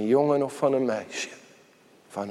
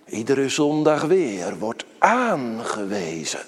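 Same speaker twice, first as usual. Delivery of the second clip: normal talking voice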